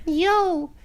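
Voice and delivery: put-on very high, helium-squeaky kid voice. Imitating his younger self roasting a kid online, in a helium-high voice. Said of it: "the really high-pitched voice".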